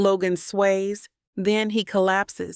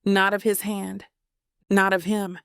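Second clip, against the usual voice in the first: ordinary delivery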